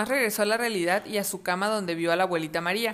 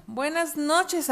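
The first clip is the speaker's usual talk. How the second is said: slow tender goodnight; 6 semitones higher, much slower than usual. Acted. Boy says goodnight.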